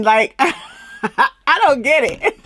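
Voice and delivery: slow, laughing disbelief. Real, not acted. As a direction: laugh through the words in disbelief. Laughing at the cast member.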